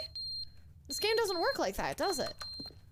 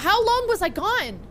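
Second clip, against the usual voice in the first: louder, slow shocked question; startled; 2 semitones higher, 9 dB louder than usual. Real, not acted. Player shocked at the decayed room, louder and slow. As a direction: ask in disbelief.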